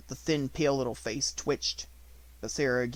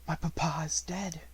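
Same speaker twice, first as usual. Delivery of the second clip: dazed whisper, not her own voice; stunned, whispered grief; hollow whisper; 4 semitones higher than usual. A narrator's reading. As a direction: murmur it to herself in shock. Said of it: "in a voice which did not seem her own".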